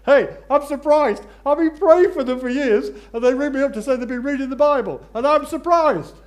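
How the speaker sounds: preacher mocking his own shock at answered prayer; mock astonishment. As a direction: ham up your own disbelief. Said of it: "And I thought to myself".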